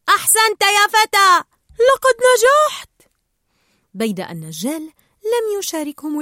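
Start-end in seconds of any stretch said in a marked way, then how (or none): 0.1-2.9 high, loud shouts of congratulation; jubilant cheer. A narrator's reading. Tank fish celebrate Nemo freeing himself, high joyful shout. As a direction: cheer him on, drawing out the last words. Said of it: "صائحين".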